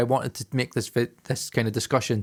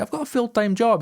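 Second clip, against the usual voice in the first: high-pitched plea in self-mockery; mock whiny excuse; 11 semitones higher than usual. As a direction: protest your excuse up high. Acts out his excuse to producer.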